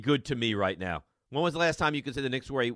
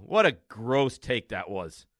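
ordinary delivery

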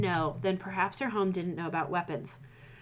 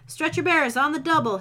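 loud, higher call for help; 7 semitones higher, 5 dB louder than usual. Acted. Surgeon calls for stretcher bearers. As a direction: call it across the camp. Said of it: "he called".

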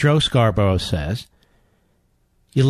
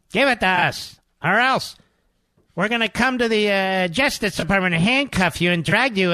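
mock menacing Democrats; 9 semitones higher than usual. Mocking Democrats as cartoon villains, high and hammy. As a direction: ham up a cartoon threat.

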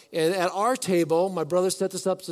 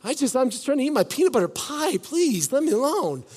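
mock whiny brush-off; 6 semitones higher than usual. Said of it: "I probably would have said".

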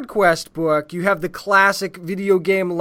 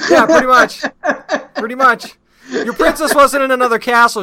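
laughing through the words; chuckling while agreeing; 5 semitones higher, 8 dB louder than usual. Real, not acted. Laughing at the joke.

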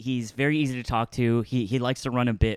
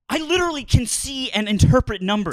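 high-pitched, exasperated comeback; squeaky with mock outrage; indignant protest; 12 semitones higher than usual. Real, not acted. Indignant reply to being mocked.

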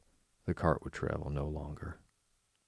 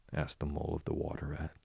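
ordinary delivery, nothing marked

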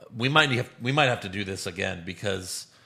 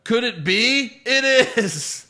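excited announcer, high and loud; Michael Cole; 10 semitones higher, 6 dB louder, much slower than usual. Retold or imitated. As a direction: belt it like a hyped commentator. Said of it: "Michael Cole catchphrases".

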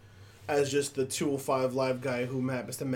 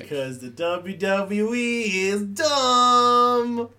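slow mocking drawl; 6 semitones higher, 6 dB louder, much slower than usual. Real, not acted. Slow drawn-out jab at WWE.

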